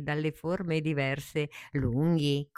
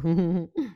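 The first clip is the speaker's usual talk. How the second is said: short high amused laugh; light giggle; fond little laugh; 4 semitones higher, 6 dB louder than usual. Acted. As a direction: laugh tenderly at the little flower. Tree laughs fondly.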